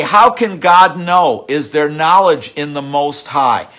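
loud, scoffing voice of the godless, slowed on the question; mocking the wicked. Preacher voicing the wicked who deny God, loud mocking scoff. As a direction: sneer it like a man who thinks God is blind. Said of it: "And they say".

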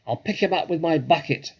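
high, loud boast; shouted. A narrator's reading. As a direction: shout it out as a threat. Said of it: "shouted Bunce".

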